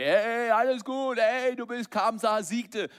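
high, loud, cheery all-is-well voice; mocking the happy-ending version; mock triumphant. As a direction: ham up the easy victory story. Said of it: "Weil wir lieben alle diese Story".

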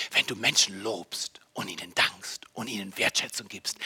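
leise, tiefe Predigerstimme, fast geflüstert; eindringlich gedämpft. Real, not acted. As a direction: die Gemeinde leise heranholen. Gedämpfter Auftakt vor lauter Steigerung. (gedämpft und eindringlich)

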